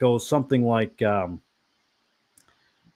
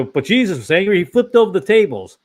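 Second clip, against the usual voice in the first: louder and higher, imitating an objector; a raised, defensive voice for someone who justifies anger; mock indignant protest; 7 semitones higher, 6 dB louder than usual. Imitating a critic who cites Jesus, raised and indignant. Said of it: "Somebody will say".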